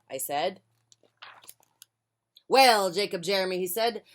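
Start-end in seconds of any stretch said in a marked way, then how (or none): 2.5-3.6 pirate voice. Acted. Pirate captain addressing boy.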